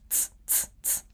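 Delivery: "ts" made only of blown air; soft, voiceless "ts" repeated; unvoiced ts. Shown as a demonstration. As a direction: puff out "ts" with no vowel. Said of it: "you just blow out air".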